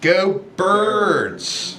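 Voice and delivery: drawn-out South Jersey Philly-fan twang; South Jersey accent. Shown as a demonstration. Showing co-hosts the Delco sound, South Jersey accent. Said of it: "what about a South Jersey accent".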